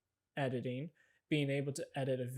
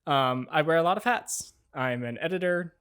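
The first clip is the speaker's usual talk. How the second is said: loud, bright-toned show-host intro; upbeat host read; 8 dB louder than usual. Acted. Host welcoming viewers to his channel, upbeat announcer voice. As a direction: sell the channel like a YouTube host.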